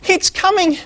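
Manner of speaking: very high, breathless fake panic; little pig faking panic to scare the squirrel; panting mock alarm. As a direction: pretend to be terrified and out of breath. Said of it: "puffing and panting so hard".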